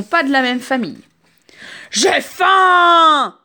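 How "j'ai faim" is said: hungry wolf shouting, high and loud; wolf yelling his hunger at the group; wolf's yell. Acted. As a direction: bellow it like a starving wolf. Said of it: "hurle le loup".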